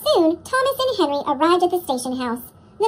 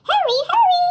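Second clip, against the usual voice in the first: very high, louder eager call; squealing hurry-up; excited cry; 13 semitones higher, 5 dB louder than usual. Acted. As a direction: coaches bursting to get going. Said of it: "Annie and Clarabelle cried".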